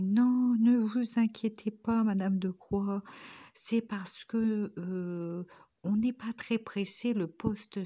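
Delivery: high, slow and loud, overly polite; imitating the director's solicitous manner; mock director voice. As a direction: put on a prim official's voice to reassure. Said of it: "ma petite voix que je viens de prendre".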